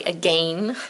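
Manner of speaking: "again" rhymed with "rain"; British pronunciation. Shown as a demonstration. Teacher explaining a failed poem rhyme, "again" with a long A. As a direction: say "again" with a long A in the second syllable. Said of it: "'cause we don't say".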